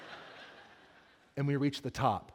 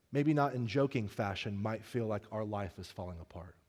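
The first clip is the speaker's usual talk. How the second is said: softer, lower comforting words; tender reassurance; 5 semitones lower, 6 dB quieter than usual. Real, not acted. Pastor comforting congregation members whose lives feel like they're falling apart, softly and tenderly. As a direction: gently tell them they're seen.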